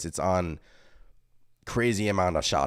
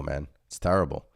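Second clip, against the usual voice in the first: ordinary talk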